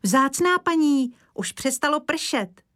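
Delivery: put-on high, eager boy's call. A narrator's reading. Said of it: "vykřikl Kája".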